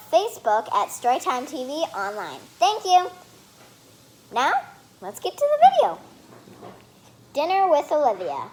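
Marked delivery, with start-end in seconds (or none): 2.6-3.1 high, loud chirpy thank you; cheerful thanks. Real, not acted. Kid thanking viewers cheerfully. 4.3-6.0 much higher, loud sing-song intro; excited hype. Real, not acted. Kid hyping start of video.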